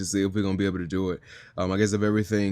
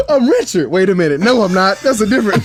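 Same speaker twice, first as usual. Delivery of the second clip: high, loud mock realization; hammed-up surprise at mixing up roles; comic double take; 11 semitones higher, 11 dB louder than usual. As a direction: play catching your own mix-up, much higher and louder. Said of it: "and I was like".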